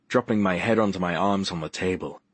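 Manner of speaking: ordinary delivery, nothing marked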